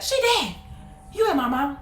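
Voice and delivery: put-on high kid's retort; defiant child. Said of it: "I was like".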